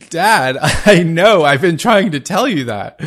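a happy laugh breaking up the line; laughing reply. Real, not acted. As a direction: laugh through the words to Dad. Laughing reply to her father.

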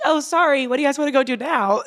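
high, fast, carefree mock; goofy Cam. Teammate imitating Cam's carefree switch after a game, high and fast. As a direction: bounce through it like nothing happened.

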